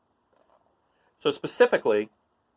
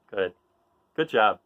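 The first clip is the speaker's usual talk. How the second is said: ordinary delivery, nothing marked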